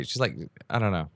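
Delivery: usual speaking voice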